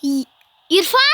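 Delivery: loud shout of the name. Real, not acted. Boy yelling at his player then apologizing, loud shout. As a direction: yell the name out. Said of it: "Çok bağırdım".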